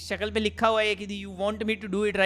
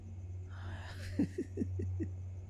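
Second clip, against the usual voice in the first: high, soft, breath-only laughter; wheezing laugh; 12 semitones higher, 12 dB quieter than usual. Real, not acted. Player laughing at lame game joke, wheezing laugh. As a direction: laugh helplessly with hardly any voice.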